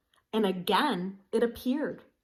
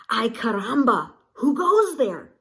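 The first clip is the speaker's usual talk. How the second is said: calling out; 3 semitones higher, 6 dB louder than usual. A narrator's reading. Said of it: "asked Skippy John Jones".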